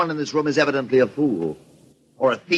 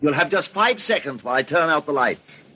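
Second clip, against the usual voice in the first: ordinary talk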